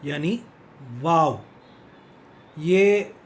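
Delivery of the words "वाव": वाव said as vaw; वाव begun like Hindi व, lip on teeth; V pronunciation. Shown as a demonstration. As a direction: say वाव with a firm English V. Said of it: "वी A".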